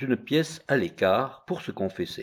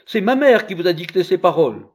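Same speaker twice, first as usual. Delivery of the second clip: put-on indignant child; 9 semitones higher, 8 dB louder than usual. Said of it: "s'écria l'enfant".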